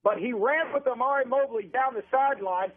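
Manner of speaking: radio football call, pitched above casual chat; live play-by-play. Real, not acted. Announcer calls a defensive play and punt to listeners, live play-by-play. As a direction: call the game live, stretching the key words.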